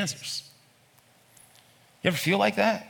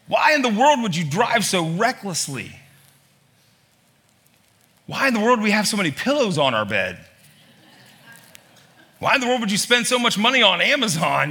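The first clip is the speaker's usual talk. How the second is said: higher, louder run of exasperated questions; exasperated parent; 8 semitones higher, 4 dB louder than usual. Retold or imitated. Exasperated parent listing complaints at home, loud high scolding. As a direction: pile up the complaints in disbelief. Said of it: "in exacerbation with your children".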